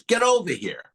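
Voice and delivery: imitating the customs officer barking an order; drill instructor attitude. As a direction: order him over like a drill sergeant. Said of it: "some boot camp drill instructor attitude".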